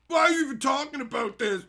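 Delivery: high, put-on shouted objection; mock complaint. Mocking commenters on his scam videos, put-on shouted complaint. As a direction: shout it as a silly heckler.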